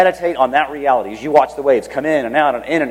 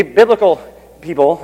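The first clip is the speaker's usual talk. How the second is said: ordinary delivery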